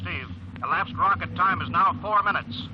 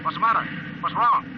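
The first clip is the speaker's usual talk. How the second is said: alarmed; 5 semitones higher than usual. Acted. Ground control alarmed by pilot.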